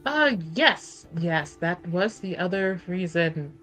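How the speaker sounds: ordinary talk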